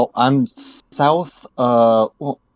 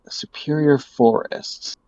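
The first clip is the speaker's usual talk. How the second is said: breathy hushed whisper; awed whisper; 3 semitones higher, 3 dB quieter than usual. Real, not acted. Caller savoring the place name, whispered in awe. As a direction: whisper it like something precious.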